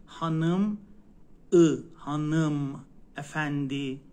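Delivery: هانم افندی slowly with dotless ı; slow Turkish model; slow demo. Shown as a demonstration. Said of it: "آی بدون نقطه".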